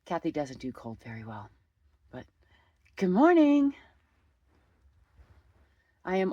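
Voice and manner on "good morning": cheery greeting. Real, not acted. Sing-song greeting.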